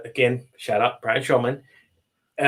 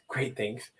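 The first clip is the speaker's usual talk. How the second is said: "Great things" dropped much softer; soft repeat; 8 dB quieter than usual. Real, not acted. Host savoring the idea, softly repeating it. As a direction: echo "Great things" quietly, to yourself.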